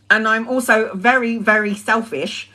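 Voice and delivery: ordinary delivery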